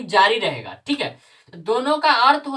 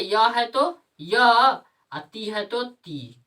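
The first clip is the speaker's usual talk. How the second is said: slow dictation; much slower than usual. Shown as a demonstration. Teacher dictating the formula.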